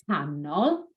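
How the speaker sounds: ordinary talk, nothing marked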